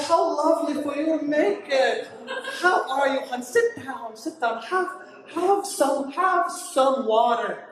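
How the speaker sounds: lightly put-on Irish brogue, mothering; Irish lady's accent. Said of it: "an Irish lady".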